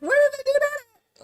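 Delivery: incredulous outrage. Real, not acted.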